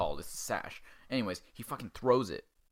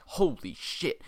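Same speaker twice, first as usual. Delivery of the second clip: higher-pitched mock shock; shocked exclamation; 6 semitones higher, much slower than usual. Teacher finds the kid drowned in the pond, shocked. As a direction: blurt it out as the alarmed teacher.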